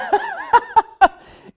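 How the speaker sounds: a big, high burst of real laughter; loud laugh. Real, not acted. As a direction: let a loud laugh explode out.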